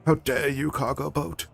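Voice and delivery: put-on high indignant teen activist voice; a light imitation of an indignant young activist; mock outraged scolding. Greta impression scolding a boat.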